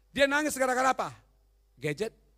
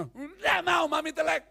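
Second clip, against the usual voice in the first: put-on sulky child refusal; 7 semitones higher, 4 dB louder, slower than usual. Preacher voices the child refusing.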